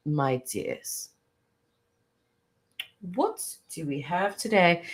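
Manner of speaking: soft, measured, proper British greeting; River impression. Fan mimicking a royal-gossip YouTuber, refined British impression. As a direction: put on a regal British host's voice. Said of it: "let me try to talk like him".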